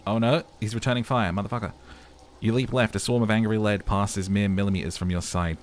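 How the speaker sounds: normal talking voice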